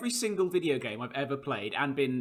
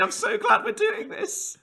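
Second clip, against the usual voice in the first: much higher, slow, sing-song and sweet; mock chirpy wife; 5 dB louder, slower than usual. Mimicking wife's cosy evening.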